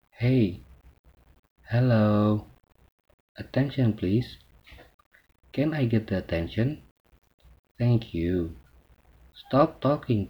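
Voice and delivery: loud, emphatic calls for a room's attention. Shown as a demonstration. Teacher modelling attention calls for students, loud and emphatic. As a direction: call the class to order, firmly.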